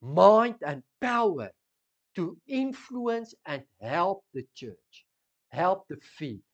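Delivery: loud, impassioned sermon delivery; pleading with the church at full force; fervent preaching. Acted. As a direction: preach it out to the congregation. Preaching with raised voice.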